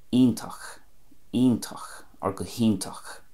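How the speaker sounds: "iontach" as EEN-takh; Connemara pronunciation. Shown as a demonstration. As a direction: say "iontach" with a clear E, keeping the ch. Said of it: "you can hear the E there".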